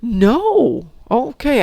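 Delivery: high shocked outcry; shocked, voice jumping up; surprised. Real, not acted. Shock at server bug.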